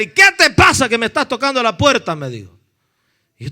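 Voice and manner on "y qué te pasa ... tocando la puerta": louder, indignant retold challenge; angry confrontation. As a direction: act out a man irked by knocking. Hostile man confronts preacher at door. (reclamo enojado, imitado)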